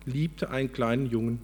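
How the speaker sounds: lively, measured storybook reading; expressive storyteller with lifted key words; story narration. A narrator's reading. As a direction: read it aloud like a parable, with pauses. Reads a story aloud.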